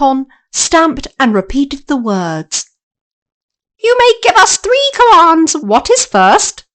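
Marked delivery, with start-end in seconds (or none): 3.8-6.7 high, loud monkey voice; flying monkeys offering Dorothy three commands; monkey servant. Acted. Monkeys answer summons.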